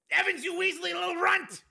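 very high, straining yell; furious insult. A narrator's reading. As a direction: hurl abuse while fighting restraints. Restrained prisoner insulting the guard.

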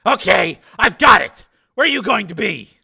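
loud, high barking chief; gruff police chief. Acted. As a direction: bark like a surly boss.

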